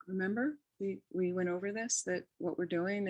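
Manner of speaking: calm reminder. Shown as a demonstration. Friend at a dying woman's bedside: soft calm reassurance. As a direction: calmly remind her, softly. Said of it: "So you can just calmly".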